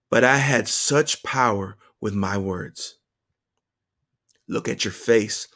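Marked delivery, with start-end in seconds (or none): none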